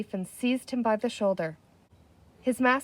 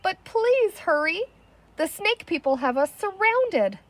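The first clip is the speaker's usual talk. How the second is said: whimpering plea; 9 semitones higher than usual. A narrator's reading. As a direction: plead in a shaky whimper. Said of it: "whimpered the chief".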